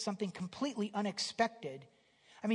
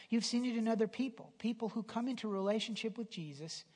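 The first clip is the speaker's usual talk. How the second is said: ordinary delivery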